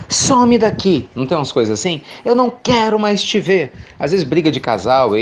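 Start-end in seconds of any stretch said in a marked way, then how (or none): 0.1-1.1 much higher, slow, louder mock yell; imitating an angry person shouting; angry outburst. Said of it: "ela tá com raiva e ela fala assim". 2.3-3.7 higher, sharp mock anger; imitating a hurt, angry partner; angry retort. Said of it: "ela tá com raiva e ela fala assim".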